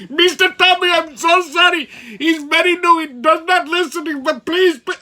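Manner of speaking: put-on high pleading Indian-accented apology. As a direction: beg forgiveness in an Indian accent. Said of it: "He goes".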